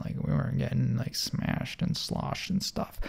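whispered aside. Acted. Aside joke about drinking.

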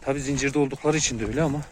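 ordinary talk

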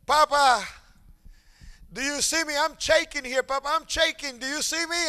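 put-on high, loud pleading child voice; shaking child. A father voicing his son, who begs for notice. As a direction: beg like a little boy shivering in the cold.

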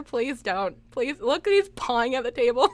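usual speaking voice

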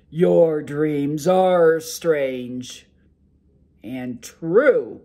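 a sleepy giant ogre grumbling to his wife; loud ogre brushing off his wife's worry. Acted. Ogre answering.

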